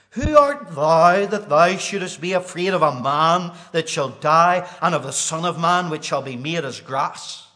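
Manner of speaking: loud, high, ringing pulpit cry; thundering preacher hammering each line; full-force preaching. Acted. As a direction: proclaim it at the top of your voice. Preaching the scripture aloud.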